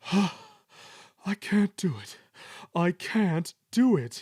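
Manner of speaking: slow, high, exhausted whine; worn-out, loud complaint; grumbling. A narrator's reading. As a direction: gasp it out in defeat. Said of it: "he grumbled".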